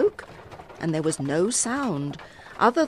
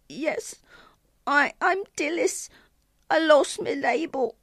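put-on high, hesitant little-girl voice; tearful little girl; 10 semitones higher than usual. A narrator's reading. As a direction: play a lost child in tears, pitched high. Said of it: "her tears now flowing".

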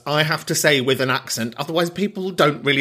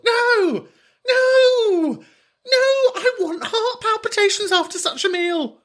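very high, stretched theatrical cry; over-the-top squealed demand; mock impassioned outburst; slower than usual. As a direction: wail it way up high in comic protest. Comic outburst demanding more salt.

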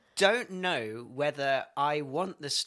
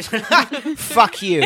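laughing mock insult; 6 semitones higher, 9 dB louder than usual. Imagined cheery reply.

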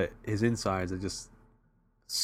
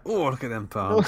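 mock flirt; 3 semitones higher, 3 dB louder than usual. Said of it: "playful flirt voice".

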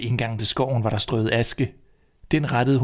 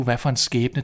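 plain reading voice

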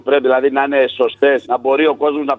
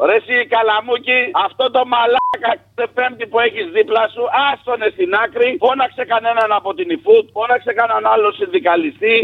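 much higher pitched call to Kalamoukis; 8 semitones higher than usual. Real, not acted. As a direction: shout the demand up high across the room. Caller calls out to Kalamoukis.

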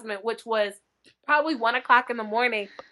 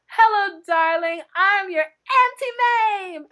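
Auntie Mame; 8 semitones higher, slower than usual. Retold or imitated. Greeting her husband and daughter at the airport like Auntie Mame. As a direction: sweep in like a grand stage aunt. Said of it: "raised up my arms and I said".

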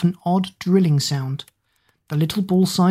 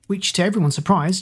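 ordinary delivery, nothing marked

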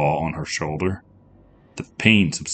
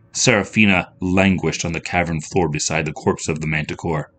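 ordinary delivery, nothing marked

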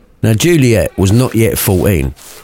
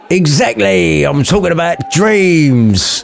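smug mate; 8 semitones higher, slower than usual. A narrator's reading. Mate voiced.

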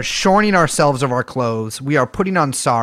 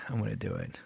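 nervous near-whisper; 6 semitones lower, 17 dB quieter than usual. Real, not acted. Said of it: "I'm having performance anxiety".

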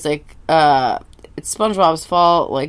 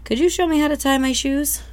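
higher, innocent asking; pleading kid; 10 semitones higher than usual. Acted. Sponge politely begging a stranger for shoe help. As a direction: plead like a hopeful little kid. Repeated plea.

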